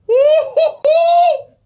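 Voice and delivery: long loud high whoop; high, drawn-out whoop of joy; joyful whoop. Real, not acted. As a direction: hoot with celebration. Celebrating the blessing.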